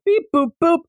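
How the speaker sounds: high beeping noises; computer beeps. Retold or imitated. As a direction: beep like an old computer.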